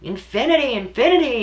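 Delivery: rising ecstatic chant of words; spirit's message. Retold or imitated. Mother retelling her son's message, rising ecstatic chant. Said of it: "his essence telling me".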